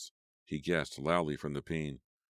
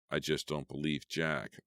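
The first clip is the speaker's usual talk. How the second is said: ordinary delivery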